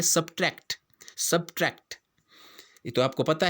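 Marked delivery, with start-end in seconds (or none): 0.0-1.9 सब्ट्रैक्ट said without the extra s, sub-tract; correct pronunciation. Shown as a demonstration. Teacher modelling सब्ट्रैक्ट for a maths word. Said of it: "जबकि वो होता क्या है".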